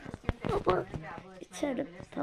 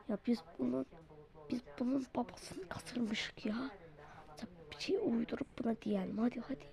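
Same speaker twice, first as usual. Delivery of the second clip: hushed conspiratorial whisper; 3 semitones lower, 14 dB quieter, faster than usual. Acted. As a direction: whisper so the captive won't hear. Kidnappers conspire secretly.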